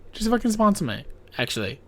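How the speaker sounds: normal talking voice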